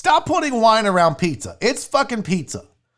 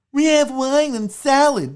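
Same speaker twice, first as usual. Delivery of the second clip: higher put-on fancy restaurant voice; exaggerated, mocking pretension; mock snob; 4 semitones higher than usual. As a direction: imitate a bougie host. Mocks the bougie pizzeria.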